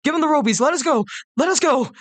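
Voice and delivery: high, frantic crowd chant; crowd yelling. Acted. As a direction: yell it like a panicked mob. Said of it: "refugees kind of yell".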